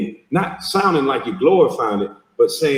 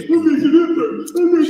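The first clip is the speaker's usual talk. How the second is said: much higher, mocking effeminate voice; 3 dB louder than usual. Man mocking men who talk so effeminate, high put-on voice. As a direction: imitate an effeminate man, pitched way up. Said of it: "you men talk so effeminate".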